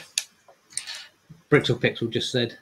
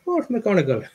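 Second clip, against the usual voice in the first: high-pitched, playful Irish lilt; mock Irish accent; 9 semitones higher than usual. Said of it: "proper Irish".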